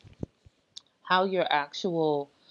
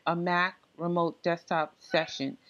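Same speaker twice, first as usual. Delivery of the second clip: usual speaking voice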